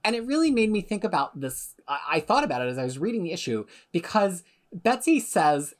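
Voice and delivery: usual speaking voice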